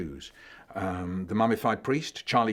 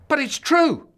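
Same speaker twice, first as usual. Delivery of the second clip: much higher, loud protest; shouting; 10 dB louder than usual. Acted. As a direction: cry out in protest. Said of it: "shouted Hanuman".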